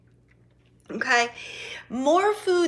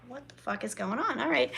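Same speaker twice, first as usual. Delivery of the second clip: muttered under breath; 7 dB quieter than usual. Retold or imitated. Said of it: "And I'm like".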